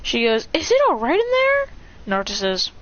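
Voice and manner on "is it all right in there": high, rising worried question; high-pitched acted concern; concerned mother. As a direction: call in with a high voice. Mother asks about screaming.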